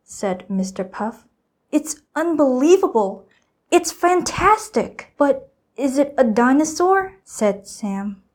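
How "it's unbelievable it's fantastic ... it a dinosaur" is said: high, loud, thrilled exclamation; amazed child. A narrator's reading. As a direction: gasp it out in delight. Sam amazed by hatched dinosaur.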